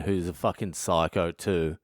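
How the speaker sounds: ordinary talk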